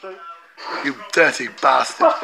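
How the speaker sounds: ordinary talk, nothing marked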